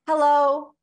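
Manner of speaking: high, loud held-breath hello; top of the breath. Shown as a demonstration. Coach demonstrating the highest range, high top-of-breath hello. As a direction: sing it out at the top of your range. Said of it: "say hello at the top of your breath".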